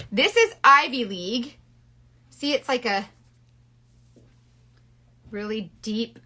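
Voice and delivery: ordinary delivery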